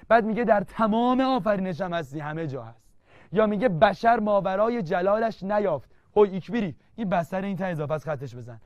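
ordinary talk

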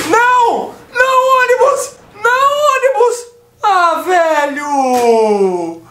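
grito agudo e indignado, quase chorando; grito de desespero. Real, not acted. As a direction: grite como quem perdeu o melhor momento. Ônibus tapa a destruição. (lamento aos gritos, quase chorando)

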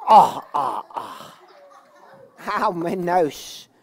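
groaning, dying-away ahs; play-acted pain. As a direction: moan slowly over a bumped nose. Pop heeft neus gestoten. (gespeeld kermen van pijn)

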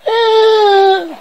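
long, high wailing cry; high-pitched wail. Acted. Reader imitates the captioned wailing, high-pitched wail. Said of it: "High pitched wailing".

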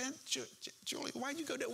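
half-gasped, high-pitched protest; high surprised protest. Retold or imitated. Nephew protesting to his elderly aunt, high and stammering.

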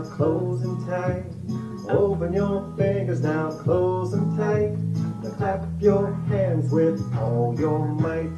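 ordinary delivery, nothing marked

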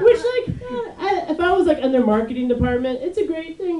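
high, giggling through the words; laughing. Real, not acted. Comic cracking up while pitching a slogan, giggling high.

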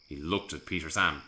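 usual speaking voice

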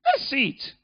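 high, doubting challenge of the world; mocking skeptics. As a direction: play the scoffer daring the church to prove it. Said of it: "They say".

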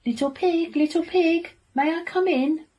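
high, loud singsong wolf call; calling at the door. A narrator's reading. Friendly wolf at the little pig's house, calling out.

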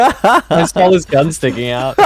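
high, slow words through laughter; laughing. Real, not acted. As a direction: laugh while telling it. Laughing about kill.

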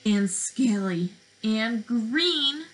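put-on lively, sing-song picture-book voice for little kids; reading to children. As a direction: read it like circle time, drawing them in.

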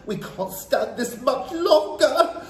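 high, drawn-out cries of revulsion; disgusted cries. A narrator's reading. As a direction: cry out at an unbearable stink. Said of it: "there were cries of".

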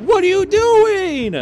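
high, loud exasperated yell at the team; angry at teammates hiding in lockers, a frustrated yell. Real, not acted. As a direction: throw your hands up and shout it.

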